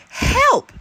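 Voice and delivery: high, loud desperate shout. Acted. Hedgehog calling for help as the mother bear arrives, loud high shout. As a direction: call out for help in real alarm. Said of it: "he shouted louder than before".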